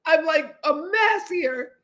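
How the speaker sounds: real amused laughter about Greg; laughing. Real, not acted. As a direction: giggle as you recall it. Laughing at the memory.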